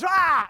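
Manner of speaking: loud, high yell to scare; startling yell. Retold or imitated. Man jumps out of the darkness at friends, startling yell. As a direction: jump out at them with a high "Ah!". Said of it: "this guy jumps out of the darkness and goes".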